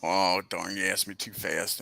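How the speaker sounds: normal talking voice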